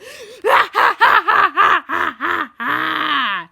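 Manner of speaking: very loud, long maniacal cackle; evil villain laugh. A narrator's reading. X celebrates controlling two friends, cackling wildly. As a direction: cackle hard, trailing out long.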